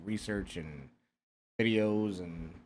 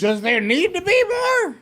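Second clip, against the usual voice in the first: high, loud exasperation; exasperated; 6 dB louder, slower than usual. Real, not acted. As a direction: throw up your hands. Exasperated disbelief.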